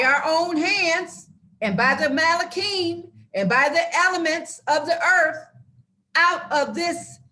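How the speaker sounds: fired-up preaching. Real, not acted. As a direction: preach hard at them, getting worked up. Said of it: "oh, let me calm down".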